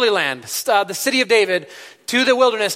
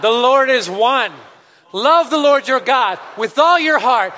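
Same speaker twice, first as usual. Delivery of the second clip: slow, high, loud congregational creed; measured, ringing declaration, phrase by phrase; passionate recitation; 5 semitones higher, 3 dB louder, slower than usual. Real, not acted. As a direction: lead everyone in proclaiming it with heart. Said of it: "say this together with feeling and heart and passion".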